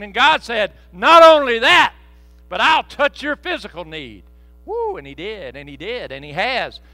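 loud, high, fervent revival preaching; preaching at full force. Acted. Pastor retelling Roger's prayer to the congregation, loud and fervent. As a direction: shout the testimony to the church.

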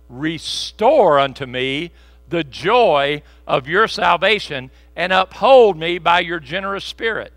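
slow, loud, ringing psalm; booming scripture reading. Acted. Preacher booming the psalm to his congregation, slow and loud. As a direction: proclaim each word to the back row.

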